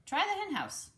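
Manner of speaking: high bleating voice; a lilting farm animal voice; sheep voice. Acted. Voiced animal in read story.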